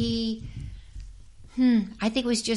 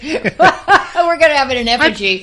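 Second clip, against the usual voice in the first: loud, high, laughing outburst; laughing joke; 7 semitones higher, 11 dB louder than usual. Real, not acted. Actress joking to interviewer about burning the film, laughing loudly. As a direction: crack up while saying it.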